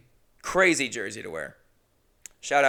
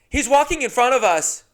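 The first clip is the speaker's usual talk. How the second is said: put-on high, loud little boy; bratty kid voice; 12 semitones higher, 10 dB louder than usual. The kid pointing at the comedian in the hallway, high and loud. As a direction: blurt it like a tactless kid.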